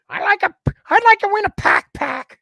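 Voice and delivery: high, loud mock toothless voice; toothless caller. Toothless girl asking on air for a prize, imitated. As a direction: imitate a gummy woman up in a high pitch. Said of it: "She had no teeth".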